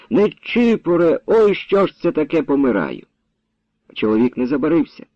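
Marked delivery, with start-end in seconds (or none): 0.1-3.1 desperate cry. A narrator's reading. Stricken wife calling husband from the shed, desperate cry. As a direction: cry out in despair, then sink. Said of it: "скрикнула відчайно".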